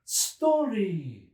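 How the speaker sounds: high, loud eager cry; the editor crying out for the story; cried out. A narrator's reading. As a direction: demand the story. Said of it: "cried the editor".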